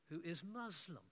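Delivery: usual speaking voice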